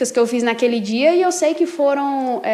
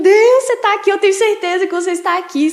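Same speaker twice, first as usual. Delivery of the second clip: crying plea; 6 semitones higher than usual. Retold or imitated. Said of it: "comecei a chorar desesperadamente".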